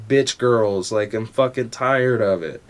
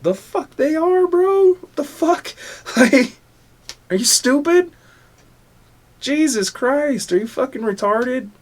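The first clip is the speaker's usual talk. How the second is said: high-pitched incredulous rant; worked-up, squeaky-high indignation; upset outburst; 12 semitones higher than usual. Real, not acted. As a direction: voice shoots way up in exasperated disbelief. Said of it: "I got a little upset".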